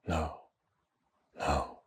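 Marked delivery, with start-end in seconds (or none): none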